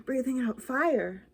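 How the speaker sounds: ordinary delivery, nothing marked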